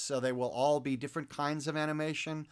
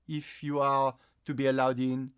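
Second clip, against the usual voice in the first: aloof, exclusive Parisian; cool Parisian accent; 5 dB louder than usual. Acted. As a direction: size up a stranger in a French accent. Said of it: "there is always a bit of cool".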